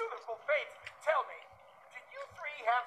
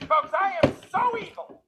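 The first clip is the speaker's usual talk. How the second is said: louder, higher comic boast; comedy setup; 6 semitones higher, 6 dB louder than usual. Acted. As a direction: boast it to the crowd like a roast comic. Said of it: "How evil are you?".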